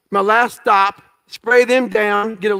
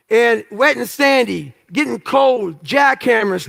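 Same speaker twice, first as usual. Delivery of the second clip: ordinary delivery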